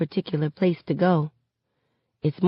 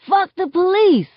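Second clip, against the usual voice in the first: high, raised collective shout; thundering crowd yell; 13 semitones higher, 4 dB louder than usual. A narrator's reading. Crowd shouting along when the hook hits, thundering yell. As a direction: yell it like a whole crowd joining in. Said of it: "thunders off Magnolia Avenue".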